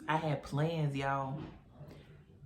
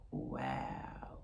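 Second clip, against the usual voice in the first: low, hushed, whispered disbelief; stunned wow; 8 dB quieter than usual. Retold or imitated. Stunned hearing the pregnancy confirmed.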